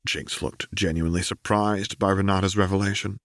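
ordinary delivery

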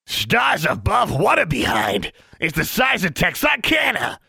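a rude pet howling with mockery; cackling creature mocking her behind; screeching creature. Honey jeering at her behind.

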